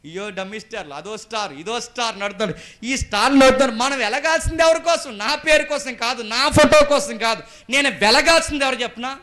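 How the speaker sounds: building to high, very loud exhortation; full-force preaching. Real, not acted. Preacher telling believers for whom to shine, loud and high. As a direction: drive it home at the top of your voice.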